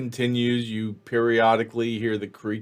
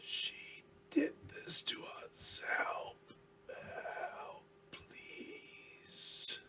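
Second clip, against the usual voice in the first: faint, drawn-out ghostly whisper; haunted, whispered plea for help; eerie second voice; 16 dB quieter, much slower than usual. Acted. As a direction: whisper a pleading lament, stretching the last words. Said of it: "you hear a second voice saying".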